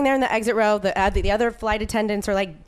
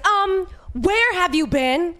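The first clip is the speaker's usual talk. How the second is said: mock scolding; 8 semitones higher, much slower than usual. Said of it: "She yelled at us".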